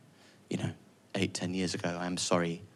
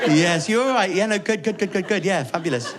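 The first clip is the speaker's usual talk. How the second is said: higher, brushing it off brightly; mock cheerful son; 7 semitones higher, 3 dB louder than usual. Son answering mum's call, falsely cheerful. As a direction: insist everything is fine. His side of the phone call.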